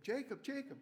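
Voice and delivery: bright high calling out a name; cheerful greeting call. Retold or imitated. Diner regulars greeting Jacob as he walks in, high cheerful call. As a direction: call his name like the whole diner is happy to see him.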